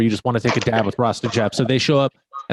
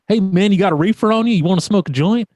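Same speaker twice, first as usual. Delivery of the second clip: a higher, louder Southern drawl offering a joint; 8 semitones higher, 4 dB louder than usual. Retold or imitated. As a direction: call out like a Southern stoner jumping into your path. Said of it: "I hear this southern drawl".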